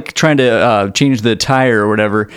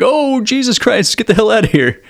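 panicked cry; 9 semitones higher than usual. Retold or imitated. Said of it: "And they're like".

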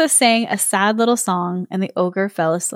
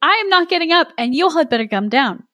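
put-on higher, firm boy voice; wary boy; 7 semitones higher than usual. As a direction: refuse the ride, a little hurried. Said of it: "said Marin".

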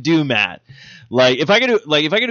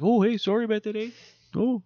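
put-on Canadian accent; 7 semitones higher, 7 dB quieter, faster than usual.

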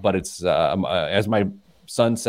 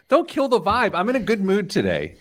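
high-pitched mock plea; playful protest; 11 semitones higher than usual. Real, not acted. Host begs co-host not to spoil his mood, high protest. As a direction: push the voice way up, playfully pleading.

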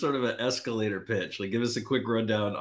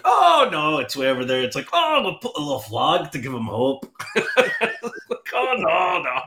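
hammed-up Scottish accent, higher and louder, Robin Williams style; mock Scottish accent; 7 semitones higher, 5 dB louder than usual. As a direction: retell the Robin Williams routine in a jokey Scottish brogue. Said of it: "slag the Irishman with the Scottish accent".

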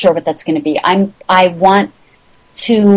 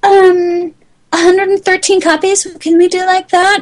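much higher, small voice, asking shyly; timid high-voiced request; 12 semitones higher than usual. Calling a printer to ask for a tiny print run, in a meek, much higher voice. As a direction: sound tiny and unsure asking a favour. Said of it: "you need, like".

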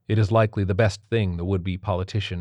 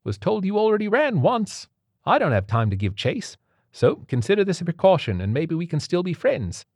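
high-pitched Cockney character voice; Cockney accent; 10 semitones higher than usual. A narrator's reading. Cockney captor explains himself to maimed captive, higher Cockney voice. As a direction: speak as a Cockney stranger, pitched up. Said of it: "spoke the Queen's English with a Cockney accent".

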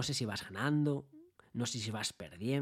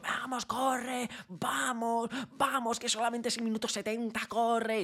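yelling at the TV; 10 semitones higher, slower than usual. Retold or imitated. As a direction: urge the team on from the sofa. Estás gritando a la televisión. (aficionado gritando a la tele, agudo y fuerte)

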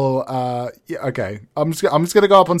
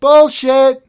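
high, loud yell from the crowd; heckler shout; 13 semitones higher, 9 dB louder than usual. Acted. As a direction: bellow it like a rowdy heckler.

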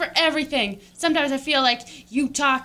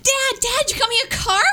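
put-on high eager kid voice; excited child; 9 semitones higher, faster than usual. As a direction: ask like a thrilled little girl. Childish daughter role.

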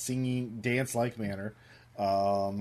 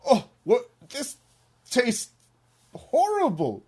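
high, halting mock choking disgust; hammed-up cartoon revulsion, broken word by word; Mister Cat; 13 semitones higher, 6 dB louder, slower than usual. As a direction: react loudly and high, gasping out each word. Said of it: "and he says".

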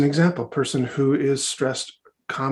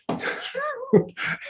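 short, high real laugh; brief amused laughter; high-pitched laugh. Real, not acted. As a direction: let out a high chuckle. Laughs before explaining.

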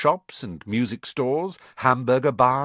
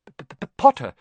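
louder, stuttering nervous teacher; nervous stammer; 6 semitones higher, 7 dB louder, faster than usual. A narrator's reading. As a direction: trip over the P in fright. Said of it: "stammered Professor Quirrell".

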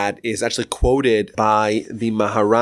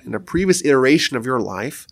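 normal talking voice

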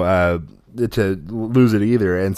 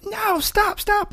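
put-on very high, panicked kid voice; high-pitched mock panic; mock freak-out yell; much slower than usual. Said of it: "I'm freaking out and I'm yelling like".

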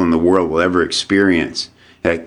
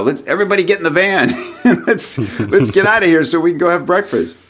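higher, fast, laughing mock rally; laughing through the quote; 9 semitones higher, faster than usual. Telling how the family rushed to breakfast, laughing while quoting. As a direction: giggle out the kid's eager call.

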